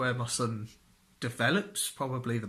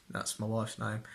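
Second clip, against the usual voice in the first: ordinary delivery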